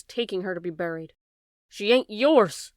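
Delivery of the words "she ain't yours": slow, louder furious scream. A narrator's reading. Bryce screaming as the father takes Sarah Ruth: slow loud scream. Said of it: "Bryce screamed".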